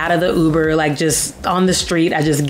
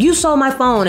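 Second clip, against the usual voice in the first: put-on raised voice pointing at the boy; angry accusation; 9 semitones higher, faster than usual. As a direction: call him out in the street. Confronting the phone thief.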